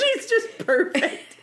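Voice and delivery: high, slow laughing praise; gushing laugh. Real, not acted. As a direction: gush it slowly through laughter.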